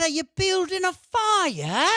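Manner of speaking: high, drawn-out bad leprechaun squeal; drunk pirate leprechaun. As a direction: double down on a terrible tipsy leprechaun voice. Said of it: "I doubled down and I repeated".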